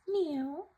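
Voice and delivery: high, sweet mock meow; kitty meow. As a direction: meow like a little kitten. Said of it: "The kitty cat says".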